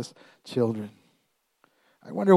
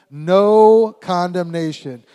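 loud, slow, higher-pitched chant; out loud together; 4 semitones higher, 7 dB louder, much slower than usual. Shown as a demonstration. Preacher leading congregation to say it together, out loud. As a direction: lead the crowd, drawing each word out. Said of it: "say it out loud together".

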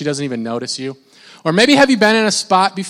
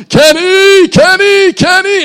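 much higher and louder yell across the stands; yelling a name; 14 semitones higher, 10 dB louder, slower than usual. Retold or imitated. Fan yelling from center field to his hero. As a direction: yell for him like a desperate fan. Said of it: "I'm yelling to Kenny Lofton".

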